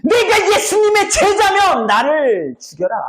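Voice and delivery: high, drawn-out vehement swearing; hammed-up desperate oath; cursing oath. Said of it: "저주하며 맹세하는 거야".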